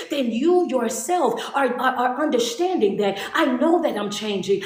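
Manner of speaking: ordinary delivery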